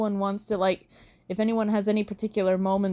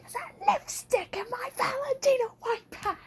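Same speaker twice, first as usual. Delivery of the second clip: much higher shrieky diva voice; diva meltdown; 4 dB quieter than usual. Retold or imitated. Player mocking a character's breakdown in a high voice. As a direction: freak out like a pampered diva. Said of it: "have a mental breakdown".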